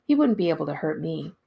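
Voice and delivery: ordinary delivery, nothing marked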